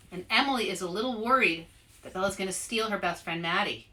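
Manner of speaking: usual speaking voice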